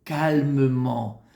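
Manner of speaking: very slow, low, drawn-out words; calm, gentle, tender. Shown as a demonstration. Rabbi showing husband how to say it at home, tenderly. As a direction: stretch each word out soothingly. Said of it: "tu dois les dire".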